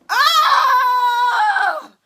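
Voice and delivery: long, high exasperated cry; high drawn-out wail. Real, not acted. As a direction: let out a long wailing "oh". Card failed to fly out.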